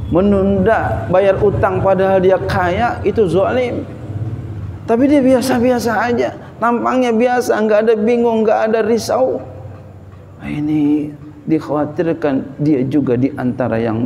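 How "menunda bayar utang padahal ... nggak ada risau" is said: high, impassioned sermon delivery; preaching. Real, not acted. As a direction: preach it urgently in a raised pitch. Preaches at raised pitch. (khotbah berapi-api, nada tinggi)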